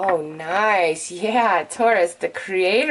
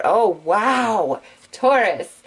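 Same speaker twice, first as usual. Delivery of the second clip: excited; much slower than usual. Real, not acted. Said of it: "I'm sorry I'm getting so excited".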